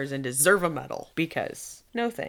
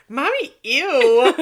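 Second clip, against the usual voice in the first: put-on high, loud, drawn-out kid disgust; disgusted little girl; 7 semitones higher, 10 dB louder, slower than usual. Daughter to mom in the bathroom, loud drawn-out disgust. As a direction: imitate a grossed-out child, stretching it out.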